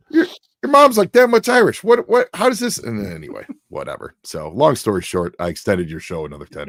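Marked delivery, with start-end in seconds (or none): 0.1-2.8 high, sputtering mock outburst; imitating a dad lost for words; bewildered dad. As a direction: stumble over words in exasperation. Dad's confusion continues.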